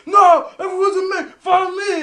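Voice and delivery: high, loud mock hysterics; imitated screaming. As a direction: yell it in a high imitation of panic. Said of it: "he's, like, screaming".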